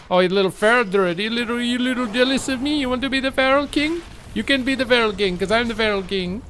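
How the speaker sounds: high, crowing voice; mock taunting. Feral druid to jealous rival, high mocking taunt. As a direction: crow over a rival in a pushed-up voice.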